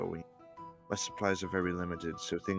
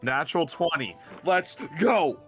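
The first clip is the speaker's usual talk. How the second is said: loud, high victory shout; excited cheer; 9 semitones higher, 9 dB louder than usual. Real, not acted. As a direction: celebrate the big roll. Player celebrates natural twenty roll.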